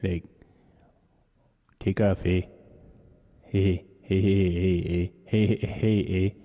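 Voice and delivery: lightly put-on Canadian accent with a tagged-on "eh"; mock Canadian accent. Streamer killing time before viewers show up, mock Canadian accent. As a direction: play at being Canadian, jokingly. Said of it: "We're gonna pretend we're Canadian".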